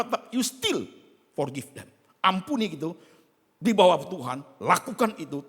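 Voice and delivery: loud, high, pounding sermon delivery; full-force preacher calling out to the crowd; fiery preaching. Acted. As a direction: preach it hard to the congregation.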